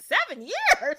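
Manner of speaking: high, laughing disbelief; incredulous princess. Acted. Princess hearing seven years, high laughing disbelief. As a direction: shoot it up high, half laughing.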